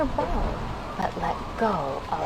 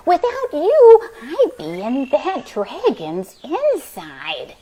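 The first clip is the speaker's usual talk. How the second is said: grateful witch; 7 semitones higher, 6 dB louder than usual. Acted. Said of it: "the grateful witch cried".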